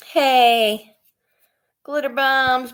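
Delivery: ordinary delivery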